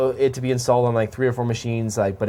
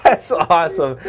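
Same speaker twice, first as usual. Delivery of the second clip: laughing; 3 semitones higher, 7 dB louder than usual. Real, not acted. Laughing at friend's take.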